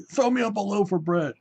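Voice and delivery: high, sustained call; retold yell. Convict begging a free loaf from the driver, mock yell. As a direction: imitate a convict hollering out of a window. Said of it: "he's yelling out through the bars".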